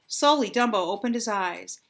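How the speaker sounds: plain reading voice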